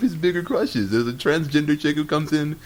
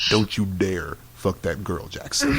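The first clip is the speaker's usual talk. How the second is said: sudden stern warning; 6 semitones lower than usual. Retold or imitated. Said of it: "he switches over to".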